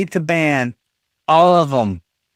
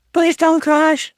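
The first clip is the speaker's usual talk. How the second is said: higher, slow begging; pleading; 12 semitones higher, slower than usual. Real, not acted. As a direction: plead with the computer. Begging the PC not to crash.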